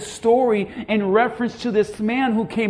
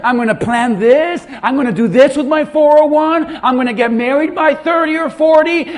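loud, raised-pitch sermon delivery; full-force preaching; 3 semitones higher, 7 dB louder than usual. Real, not acted. Preacher warning congregation about tomorrow's plans, full-force preaching. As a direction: press the warning hard on the listeners.

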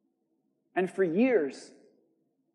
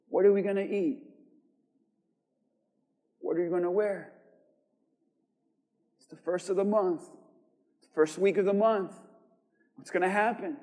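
mock worrier; 3 semitones lower, 5 dB quieter than usual. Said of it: "imitating worriers again".

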